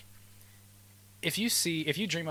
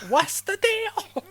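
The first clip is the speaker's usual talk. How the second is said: very high, slow, loud squeak; squeaky voice; 8 dB louder, much slower than usual. Pretend. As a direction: stretch it in a squeal.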